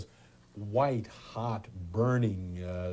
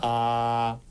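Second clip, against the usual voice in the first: saying ah; 3 dB louder than usual. Shown as a demonstration. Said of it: "And say, "Ah".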